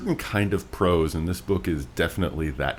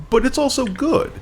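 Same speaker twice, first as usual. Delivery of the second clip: much higher, louder playful aside; playful squeak; 3 dB louder than usual. Acted. Host admitting flowery prose is fun, high playful squeak. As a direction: toss off a squeaky little concession.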